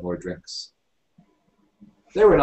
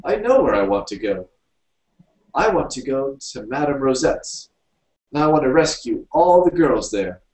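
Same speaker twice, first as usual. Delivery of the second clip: loud, raised character voice; Stuffy announcing his plan; 7 semitones higher, 6 dB louder than usual. A narrator's reading. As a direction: declare your plan firmly, higher and louder.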